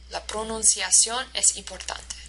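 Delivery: even beat through "La pronunciación es importante"; syllable-timed Spanish rhythm. Shown as a demonstration. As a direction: give every syllable of "La pronunciación es importante" equal weight. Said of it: "every single syllable is stressed in the same way".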